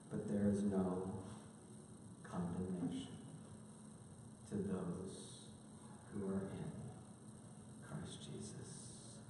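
ordinary delivery

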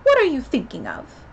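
scolding storybook question; severe. A narrator's reading. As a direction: demand it like a cross Mouse. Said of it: "said the Mouse to Alice severely".

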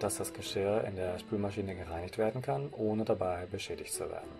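ordinary delivery

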